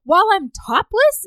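hammed-up scandalised question; mock indignant woman. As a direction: play her scandalised. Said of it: "and she's like".

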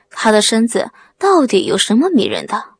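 ordinary delivery, nothing marked

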